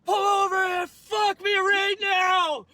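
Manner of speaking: high, slow, drawn-out crying scream; hammed-up tearful screaming from the back seat; mock screaming. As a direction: wail the order like a hysterical girl. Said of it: "she starts screaming at me".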